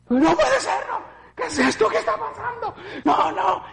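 high, frantic cries of disbelief; panicked disbelief. Retold or imitated. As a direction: relive the horror half-laughing in panic. Reliving shock at the scene. (incredulidad aterrada, aguda y nerviosa)

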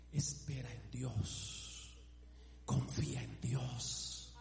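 quiet prayerful sermon voice; soft intimate preaching. Acted. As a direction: lean in close and speak gently to the hurting.

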